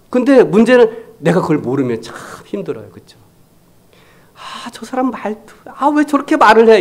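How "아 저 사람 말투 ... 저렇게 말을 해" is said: mock irritated griping about someone's tone; annoyed complaint. Pastor mimicking an irritated listener, mock annoyed. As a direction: whine about how that person talks.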